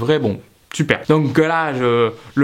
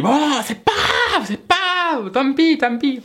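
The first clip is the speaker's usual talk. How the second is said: forced resignation; 10 semitones higher than usual. Retold or imitated. Customer tells himself the delay is fine, high forced acceptance. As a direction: wail it high, pretending not to mind.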